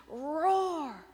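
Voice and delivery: long, loud, swelling roar; lion's roar. Acted. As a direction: stretch the word into a roar. Lion demonstrates roaring.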